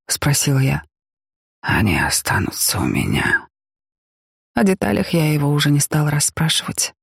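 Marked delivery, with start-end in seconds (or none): 1.6-3.5 whisper. A narrator's reading. Helmut answers at the grave.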